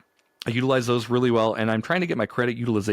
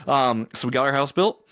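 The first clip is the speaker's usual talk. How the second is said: normal talking voice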